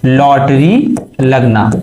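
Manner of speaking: Lottery लगना said slowly, word by word; slow repeat for class. Shown as a demonstration. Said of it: "क्या लगना?". (धीरे-धीरे, ज़ोर देकर)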